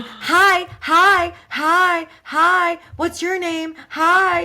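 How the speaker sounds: high, loud repeated greetings; popping-up twins. Retold or imitated. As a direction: pop up with eager, identical hellos. Voices twins popping their heads up.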